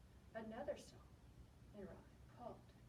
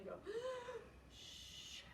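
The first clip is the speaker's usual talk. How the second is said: usual speaking voice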